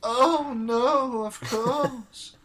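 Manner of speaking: put-on high, thin old lady voice; fussy elderly landlady showing rooms; very old woman. [name] answers.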